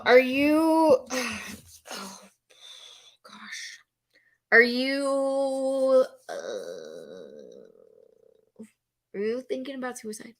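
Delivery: breaking off, sighing, whispering oh gosh, then rushing it; flustered hesitant ask. Shown as a demonstration. Trainer showing a panicked helper fumbling the question. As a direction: stumble nervously into the question. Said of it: "Those are very different".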